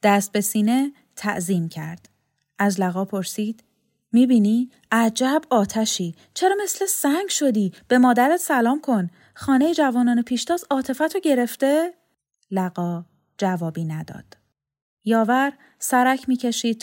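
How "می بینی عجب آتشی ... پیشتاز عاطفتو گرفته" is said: higher, louder, lively questioning; old man pressing her with exclamations and questions; animated old man. Acted. Old Yavar questions Lagha.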